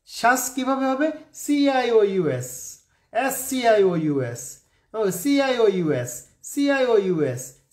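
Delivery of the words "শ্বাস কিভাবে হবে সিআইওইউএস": ordinary delivery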